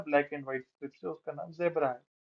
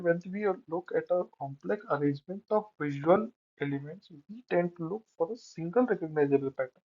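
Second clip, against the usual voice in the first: slow, careful reading from a slide; slow text reading; much slower than usual. Real, not acted. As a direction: read it word by word. Reads slide text aloud. (धीरे-धीरे पढ़ना)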